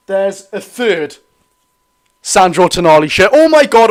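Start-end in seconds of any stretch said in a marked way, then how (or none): 3.3-3.9 sudden high, loud oh my god; excited outburst. Real, not acted. Noticing the cup draw has begun, excited outburst. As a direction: caught off guard, let it burst out.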